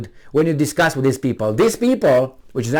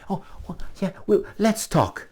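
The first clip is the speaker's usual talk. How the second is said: meek mock reply; 4 semitones higher, 6 dB quieter than usual. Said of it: "how would you say".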